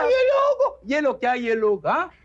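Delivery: mocking echo. Boss cuts off his employee, mockingly echoing her.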